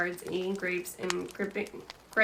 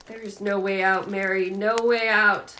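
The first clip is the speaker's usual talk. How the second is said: louder, slowing, despairing insistence; desperate; 8 dB louder than usual. Acted. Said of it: "wild and desperate".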